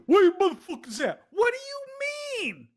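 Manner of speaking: high-pitched imitation of an irate teammate; squeaky indignant yell. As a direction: squeal it in mock outrage. Imitates teammate's squeaky angry yelling.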